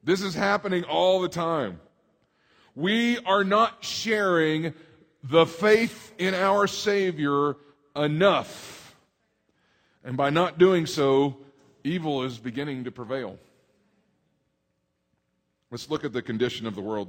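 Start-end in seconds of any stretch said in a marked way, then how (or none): 2.8-11.4 slow, louder emphatic preaching. Acted. Pastor urging his congregation, slow forceful preaching. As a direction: lean on each phrase to the pews.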